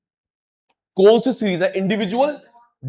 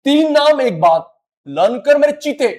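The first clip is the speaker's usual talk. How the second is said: excited urging; 7 semitones higher, 6 dB louder than usual. Real, not acted. Teacher urging students to learn.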